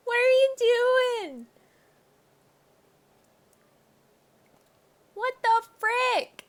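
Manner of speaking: high, loud disbelieving cry; stunned disbelief. Real, not acted. Player reacting to Carmen in the fire, stunned. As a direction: still can't believe it.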